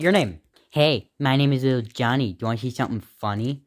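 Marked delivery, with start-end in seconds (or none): none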